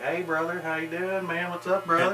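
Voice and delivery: high, imitated Kentucky twang. People walking in down in Kentucky, high twangy greeting. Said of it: "people would walk in and be like".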